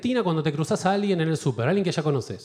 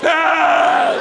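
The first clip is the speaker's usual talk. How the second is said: loud collective gasp of shock; shocked gasp shout; 9 semitones higher, 8 dB louder than usual. Retold or imitated. Everyone in the pharmacy gasping, loud shocked cry.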